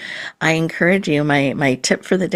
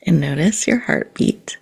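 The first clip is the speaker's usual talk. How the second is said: guided meditation. Acted. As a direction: gently guide the listener into calm and presence.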